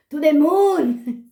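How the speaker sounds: loud, high, drawn-out recital; mock-dramatic cry. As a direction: overblown poetic outburst. Hammed-up repeat of poem line.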